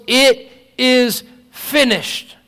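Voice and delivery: slow, high, loud proclamation; drawn-out loud declaration from the cross; loud cry. Retold or imitated. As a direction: cry it out loud like a final triumph. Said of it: "He probably cried out in a loud, a loud voice".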